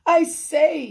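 loud, high call. A narrator's reading. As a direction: call out to get attention. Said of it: "he called".

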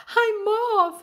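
high, loud panicked exit line; crying out. A narrator's reading. Frightened fisherman abandons his net, crying out. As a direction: yell it high as you flee. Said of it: "he cried".

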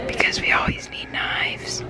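secret whisper to the camera. Real, not acted. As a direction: whisper it so nobody hears. Secret gift plan told to the camera.

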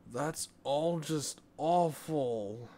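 put-on disgusted boy. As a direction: wrinkle your nose and whine it. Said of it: "with a wrinkled up nose".

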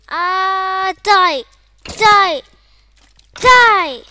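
loud fighting shouts; battle yells. Real, not acted. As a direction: yell at the mob as you strike. Fighting mobs.

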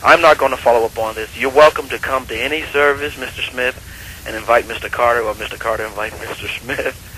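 usual speaking voice

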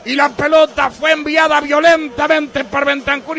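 screaming goal call, high and loud; breakneck goal commentary. Real, not acted. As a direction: roar the equaliser over the radio.

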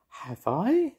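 high-pitched wondering fairy; piping fairy question. A narrator's reading. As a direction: ask it in a tiny high voice. Fairy figure asks in story.

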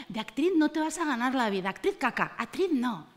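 usual speaking voice

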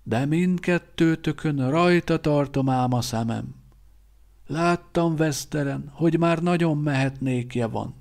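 put-on a knowing old teacher teasing; old quartermaster Szíba. A narrator's reading. Szíba voiced.

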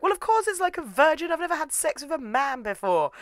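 high-pitched, exasperated protest; mock indignant retort.

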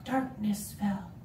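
low, quiet, spaced-out phrases; hushed storytelling. Acted. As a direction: set a still nighttime scene, softly. Hushed start of a told story.

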